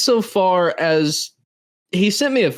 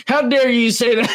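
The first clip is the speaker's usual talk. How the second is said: mock indignation; 6 semitones higher than usual. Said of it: "as soon as something was said".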